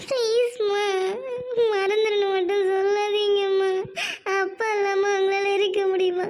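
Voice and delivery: tearful begging. Acted. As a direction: sob out the plea to her mother. Daughter begs not to forget lover.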